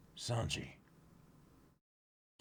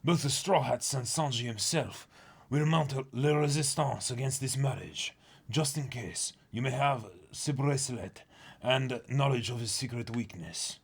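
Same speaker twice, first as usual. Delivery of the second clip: higher, hammed-up French narrator; mock French accent; 4 semitones higher than usual. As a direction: read it like a silly Frenchman. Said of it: "Français".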